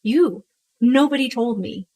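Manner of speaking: ordinary delivery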